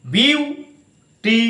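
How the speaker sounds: বিউটিফুল spoken very slowly, বিউ-টি-ফুল one syllable at a time; teacher drawing out বিউটিফুল syllable by syllable; slow syllable demo. Shown as a demonstration. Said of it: "বিউ, B E A ইউ".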